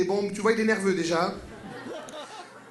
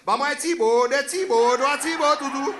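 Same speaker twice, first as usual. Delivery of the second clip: put-on loud Antillean French accent; Caribbean creole accent; 2 semitones higher, 4 dB louder than usual. Caribbean man teasing uptight French, creole accent. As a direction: tease the French as a laid-back islander.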